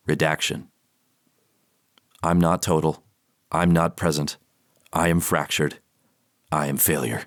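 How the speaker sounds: a low, grave reading of dark verse; poem reading. Acted. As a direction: read each verse as a somber poem. Reads poem aloud.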